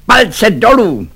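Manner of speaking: high, slow imperious cry; shouted order. A narrator's reading. Lieutenant turns in the doorway to Švejk, shouting an order. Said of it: "nevykřikl na Švejka".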